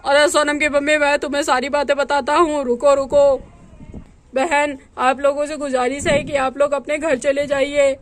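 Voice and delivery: a nervous husband dreading home; worried man. Acted. Husband tells brother-in-law he fears going home, low worried male voice.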